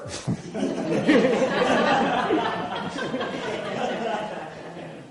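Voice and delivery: real, long amused laugh; hearty laughter at the end of the tale. Real, not acted. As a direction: laugh out at your own story. Laughs after the punchline.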